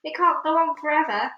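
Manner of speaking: high loud yell. Acted. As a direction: keep yelling in alarm. Continued yell.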